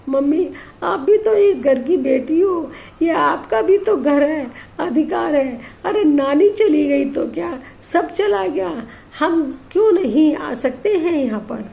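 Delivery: put-on a young girl standing up for her mum; young daughter voice. Daughter urges mother she belongs here, girlish protest.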